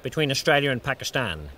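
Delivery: calm, measured broadcast voice; a dry, understated cricket commentator opening the match; TV cricket commentary. Acted. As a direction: welcome the viewers on air.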